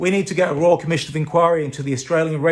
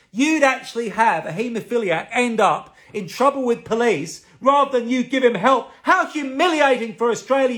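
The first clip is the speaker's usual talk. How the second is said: pitched higher, accusing the minister; indignant tirade; 6 semitones higher than usual. Real, not acted. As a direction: hurl it at the Health Minister, voice climbing. Berating the Health Minister live.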